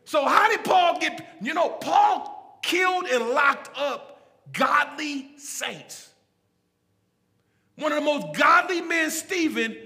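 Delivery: loud, driving pulpit delivery; full-force preaching. Real, not acted. Preacher driving home grace to his congregation, full-force preaching. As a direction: preach it hard, punching key words.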